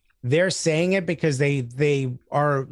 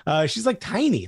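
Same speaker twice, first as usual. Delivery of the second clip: gushing about her; 6 semitones higher than usual. Retold or imitated. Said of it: "I'm like".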